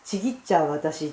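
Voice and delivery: usual speaking voice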